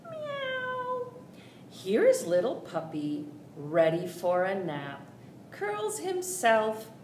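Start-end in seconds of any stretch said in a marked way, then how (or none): 0.0-1.1 high, soft kitten mew; kitty meow. Pretend. Storyteller meowing as the little kitty, high and soft.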